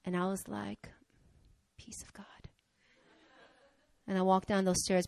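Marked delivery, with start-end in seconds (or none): 1.8-2.2 barely audible, self-restraining whisper; whispered blessing. Retold or imitated. Woman answering a man who cursed her, whispered. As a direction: breathe it out almost silently. Swallows anger with quiet blessing.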